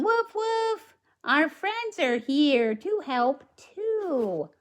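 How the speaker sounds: put-on bright, high read-aloud voice for the little girl; high storybook girl.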